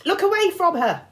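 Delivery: high loud urgent shout. A narrator's reading. Girl warning her friends of the monster, loud shout. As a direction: yell the warning. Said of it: "Annabeth shouted".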